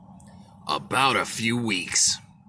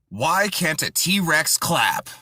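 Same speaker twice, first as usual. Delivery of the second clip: normal talking voice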